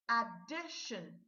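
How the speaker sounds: "addition" said slowly as a-DI-shun; drawn out, clear syllables in "addition"; slow model word. Shown as a demonstration. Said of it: "Number four".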